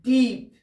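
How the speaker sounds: "deep" said loud and falling; emphatic deep. Shown as a demonstration. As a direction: punch "deep" hard and loud. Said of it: "when I say deep".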